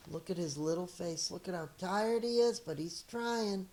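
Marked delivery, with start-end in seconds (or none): none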